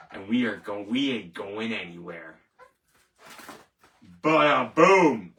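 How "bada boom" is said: slow, loud showman's "Bada boom"; punchy flourish. Host punctuating his boast, loud and slow. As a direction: land it like a drum hit.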